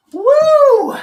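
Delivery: high, loud victory whoop; triumphant woo. Real, not acted. Finishes the exercise set.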